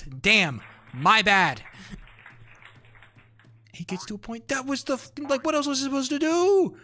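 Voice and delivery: high, loud, fed-up protest; exasperated rant. Real, not acted. Streamer defending his prison guard bits to chat, exasperated yelling. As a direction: insist furiously you were only doing your job. Streamer keeps ranting about the event.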